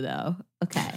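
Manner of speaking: ordinary delivery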